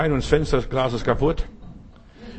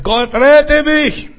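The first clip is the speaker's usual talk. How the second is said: cried out high, loud and slow; a drowning man's scream to God; howling plea for rescue; desperate cry; 13 semitones higher, 10 dB louder, much slower than usual. Retold or imitated. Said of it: "Und er schreit".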